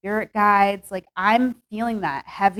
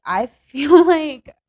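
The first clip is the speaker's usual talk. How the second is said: higher, louder, drawn out through a laugh; 6 semitones higher, 7 dB louder, much slower than usual. Real, not acted. Tarot reader amused by what she sees for Pisces, laughing. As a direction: break into a real giggle mid-phrase. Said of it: "sorry I'm laughing".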